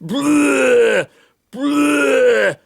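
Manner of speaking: hacking smoker's cough after a laugh. Acted. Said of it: "that happens every time I smoke".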